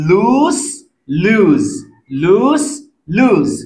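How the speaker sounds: লুজ with a long stretched oo; lengthened vowel. Shown as a demonstration. Teacher shows students the long oo in লুজ. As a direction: draw out the double vowel in লুজ. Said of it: "double vowel একটু উচ্চারণটা lengthy করবো".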